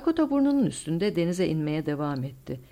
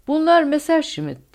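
high, loud, drawn-out cry of joy; joyful shout; 11 semitones higher, 9 dB louder than usual. A narrator's reading. Co-pilot recognizing friendly planes, joyful shout. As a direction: shout it out with delight. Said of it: "diye bağırdı yardımcı pilot sevinçle".

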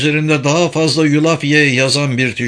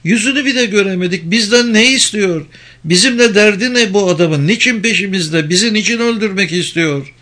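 high-pitched, pleading woman's role; distressed Penelope; 8 semitones higher than usual. Acted. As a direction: pitch it up, urgent questions. Voices distressed Penelope in dialogue.

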